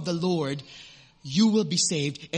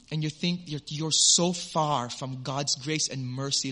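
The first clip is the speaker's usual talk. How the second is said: ordinary talk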